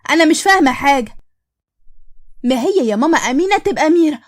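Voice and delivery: ordinary delivery, nothing marked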